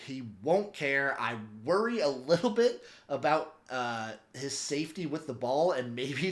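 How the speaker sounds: ordinary delivery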